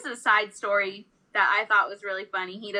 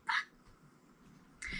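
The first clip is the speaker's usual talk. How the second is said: a soft unvoiced fuck under the breath; whispered curse; 13 dB quieter than usual. To herself after saying pod, a whispered curse. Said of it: "and I'm like".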